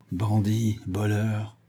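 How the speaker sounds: understated grating insult. A narrator's reading. Bound Vorski insults his captor, grating. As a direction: grind the words out with contained anger. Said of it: "grinça Vorski".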